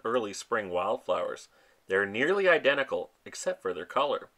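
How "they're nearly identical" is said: normal talking voice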